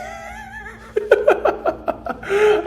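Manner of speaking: high burst of real laughter. Real, not acted. Streamer cracking up at his own ASMR try, laughing. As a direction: crack up after the whisper.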